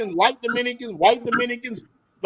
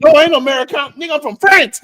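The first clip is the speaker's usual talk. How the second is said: retold protest; 6 semitones higher, 6 dB louder, faster than usual. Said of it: "They're like".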